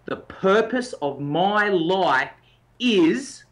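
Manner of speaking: said slowly and deliberately; slow statement. Shown as a demonstration. Coach showing the statement to the listener, slow and deliberate. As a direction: lay out each word of the statement slowly. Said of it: "the little statement that you need to work with is".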